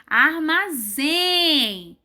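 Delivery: Armazém syllable by syllable, ending strongest; stress on ZÉM. Shown as a demonstration. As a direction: stretch Armazém and hold the final zém. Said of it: "Com mais força do que o arma".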